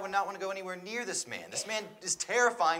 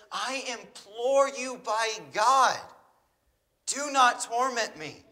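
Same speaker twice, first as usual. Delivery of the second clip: begging; 3 semitones higher, 3 dB louder, slower than usual. Retold or imitated. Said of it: "He begs him".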